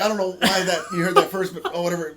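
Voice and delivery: bad fake acting. Man faking his death for onlookers, badly acted excuses. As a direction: play it as an unconvincing actor. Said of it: "his acting is horseshit".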